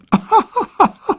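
a big high hooting laugh; loud laugh. A narrator's reading. Reporter laughs out loud at Otto with a trunk. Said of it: "ertönt plötzlich ein lautes Lachen".

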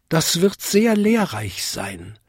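high little bell voice; Vinzi. A narrator's reading. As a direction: tinkle it high as a tiny bell. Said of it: "bimmelte Vinzi". (hoch bimmelndes Glöckchen)

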